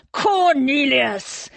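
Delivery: drawn-out furious bellow; roar. A narrator's reading. Thomas calling out his treacherous cousin, roaring. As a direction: roar the name out, high and long. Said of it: "he roared".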